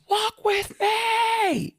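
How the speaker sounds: very high, slow, drawn-out cry; Lucifer's shout. Retold or imitated. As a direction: stretch the call out like a last desperate summons. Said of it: "Lucifer shouts".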